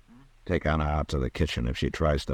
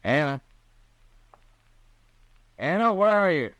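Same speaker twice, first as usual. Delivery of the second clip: high, raised call for someone out of sight; 13 semitones higher, 4 dB louder than usual. Acted. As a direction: call her name searchingly into the house. Marshal calls for Anna out of sight.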